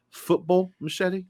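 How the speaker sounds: high, mock English-accented "Football"; put-on English accent, querying; correcting pronunciation. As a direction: say "Football" as a pointed British correction. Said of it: "It's pronounced football".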